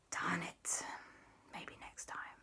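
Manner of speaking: very soft, deflated mumble; quiet resignation. Acted. Family gives up for now, hushed disappointment. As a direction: let it fade almost to nothing. Group resigned after escape.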